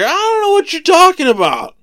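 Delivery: high, loud acted outburst; exasperated denial. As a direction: play Peter protesting far too much. Peter's third denial, retold.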